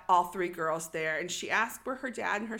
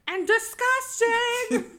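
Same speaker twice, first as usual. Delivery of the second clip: high, loud, dragged-out mock insult; hammed-up villain's yell with a laugh; mimicked disgusted shriek; 13 semitones higher, 7 dB louder, much slower than usual. Host joins the mimicry of Nancy, shrieking in mock disgust.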